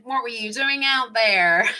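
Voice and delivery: a hint of a dad's needling question; teasing dad tone. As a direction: ask it like a dad ready to make fun. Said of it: "In the tone of voice that only dads can have".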